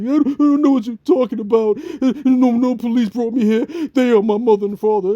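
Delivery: put-on high, near-tears kid voice; whimpering little brother. Little brother pleading with Bill, mock whimper. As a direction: protest like a scared little kid. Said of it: "whimpering about to cry".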